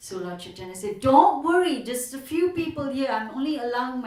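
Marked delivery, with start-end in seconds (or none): 1.1-4.0 reassuring the trembling Qazi loudly; hearty reassurance. Retold or imitated. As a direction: brush his fear aside with a big voice. Chaitanya reassures the frightened Qazi.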